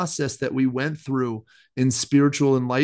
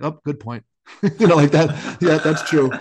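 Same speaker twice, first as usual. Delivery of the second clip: conceding God voice; 5 dB louder than usual. Retold or imitated. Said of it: "And he's like".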